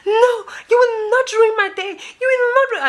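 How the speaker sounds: very high tearful whine; mock crying woman. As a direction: wail the protest in her put-on voice. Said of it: "now you're crying".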